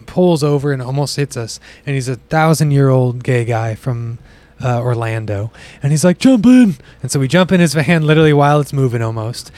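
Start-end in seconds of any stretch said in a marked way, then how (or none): 6.2-6.7 mock eager driver. Old driver offering ride.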